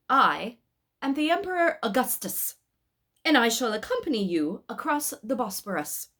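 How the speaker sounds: the Emperor Augustus commanding the pilgrims, grand and imperious. Acted. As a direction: proclaim it grandly, giving orders.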